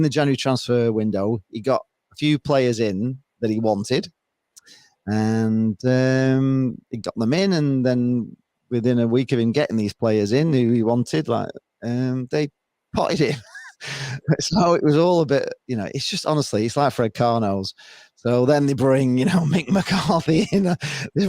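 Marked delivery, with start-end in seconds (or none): none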